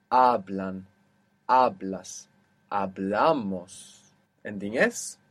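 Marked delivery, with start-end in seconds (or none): 0.1-2.3 hablan, hablas, each stressed on the first syllable; penultimate stress. Shown as a demonstration. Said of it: "natural accent will be on the penultimate". 2.7-4.0 hablamos, drawn out slowly on BLA; slow model of hablamos, stressing BLA; penultimate stress. Shown as a demonstration. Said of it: "the second last syllable".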